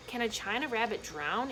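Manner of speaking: usual speaking voice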